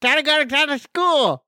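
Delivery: locked jaw voice. Retold or imitated. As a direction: mimic a jaw locked open. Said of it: "And I'm like".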